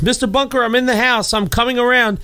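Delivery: high call through the house. Acted. Rescuer coming round to trapped Archie, calling out. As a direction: play a rescuer announcing himself. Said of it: "somebody's banging at and saying".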